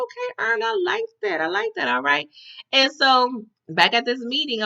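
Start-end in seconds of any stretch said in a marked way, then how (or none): 0.0-2.3 impressed high praise. Retold or imitated. Teller praising Earn's hustle.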